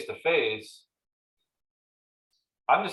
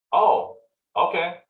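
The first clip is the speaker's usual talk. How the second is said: higher, slow, taken-aback acceptance; mild surprise; 7 semitones higher, 4 dB louder, slower than usual. Retold or imitated. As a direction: let the surprise sink in slowly. Seller hears lower list price.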